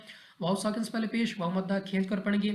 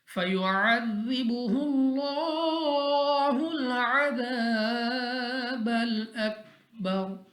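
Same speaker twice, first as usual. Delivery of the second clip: slow, drawn-out, higher melodic recitation; Quran recitation; 4 semitones higher, much slower than usual. Acted. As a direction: chant each verse slowly with long held vowels. Recites Quran verses slowly.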